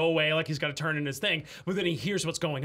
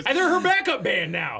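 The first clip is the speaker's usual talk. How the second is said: excited shout; 6 semitones higher, 5 dB louder than usual. Real, not acted. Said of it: "Yeah, she's like".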